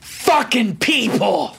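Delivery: slow, drawn-out imitated curse; mock exasperation. Dog owner after hanging up, exasperated curse. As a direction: stretch it out, lightly fed up.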